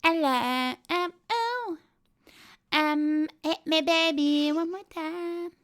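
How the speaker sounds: put-on breathy teen pop star.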